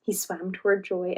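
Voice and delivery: ordinary delivery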